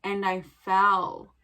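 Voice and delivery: ordinary delivery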